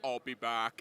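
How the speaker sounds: lightly voiced low Terminator line; a hint of Arnold's deep accent; Arnold impression. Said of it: "did Arnold, uh, can you say".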